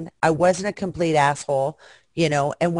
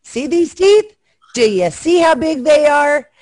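higher and louder, playfully menacing; mock threat; 9 semitones higher, 5 dB louder than usual. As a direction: warn him with a teasing snarl.

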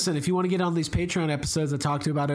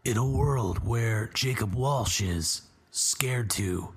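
low, slow movie-trailer narrator; 6 semitones lower, slower than usual. Pretend. Host does his movie guy impression to tease his co-host, low and slow. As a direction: growl it like an epic trailer announcement. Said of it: "Is that your best impression?".

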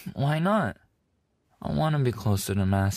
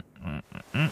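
normal talking voice

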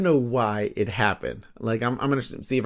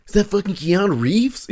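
higher, louder, stunned fan voice; mock amazed viewer; 8 semitones higher, 4 dB louder than usual. Future kid watching the riot footage, mock amazed. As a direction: gush like a kid spotting a movie star.

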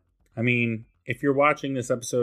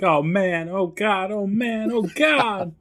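high wail, climbing with each cry; mock panic; 9 semitones higher, slower than usual. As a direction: imitate a frantic, hammed-up lament. Co-host mimics Janet's panic.

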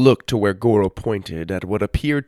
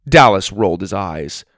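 usual speaking voice

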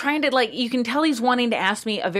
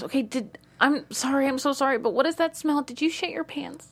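high, apologetic, hesitant question; nervous imitation, pitched well up; imitated nervous man; 7 semitones higher than usual. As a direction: tiptoe anxiously into an awkward question. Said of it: "He's, like, nervous".

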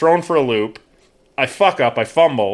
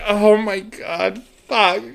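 drawn-out higher groan of mock dismay; retold mortified reaction; 6 semitones higher, much slower than usual. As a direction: stretch it out as if sinking with embarrassment. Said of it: "I'm just like".

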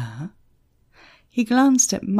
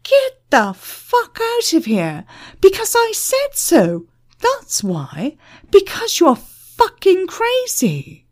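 high, loud, exasperated tirade; furious shouting; 13 semitones higher, 5 dB louder than usual. A narrator's reading. Manager at the end of his tether with Emma, shouting. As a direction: shout her down, voice leaping high. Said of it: "the manager shouted".

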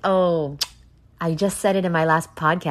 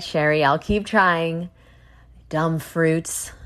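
normal talking voice

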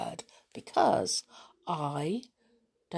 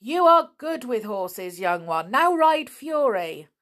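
approving; 5 semitones higher, 6 dB louder than usual. Acted. Trainer praising Nobby.

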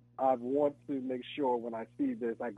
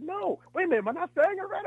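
much higher, puzzled, lightly voiced co-star; lightly imitating a puzzled woman; co-star's puzzled voice. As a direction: play her confused, asking if it's wrong. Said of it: "She said".

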